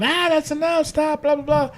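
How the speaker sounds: high but softened mock scream; Stephanie screaming. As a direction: imitate a screamed protest without the volume. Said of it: "you hear Stephanie screaming".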